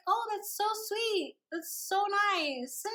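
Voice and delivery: high, falsely sweet; fake sweet. Faking sweetness.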